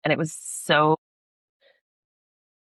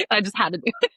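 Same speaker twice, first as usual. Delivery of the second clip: laughing; 9 semitones higher, faster than usual. Real, not acted. Laughing about her first swear word.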